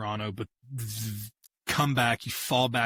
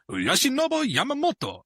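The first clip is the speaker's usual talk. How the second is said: high, hammed-up French Yoshinobu Yamamoto; mock French accent; 13 semitones higher, 4 dB louder than usual. After the dare he tries again, hammed-up French accent. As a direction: pitch Yoshinobu Yamamoto way up with a silly French flair. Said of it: "V V".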